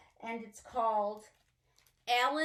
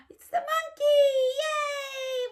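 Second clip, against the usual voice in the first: excited squeal; 9 dB louder than usual. Acted. Excited monkey reveal.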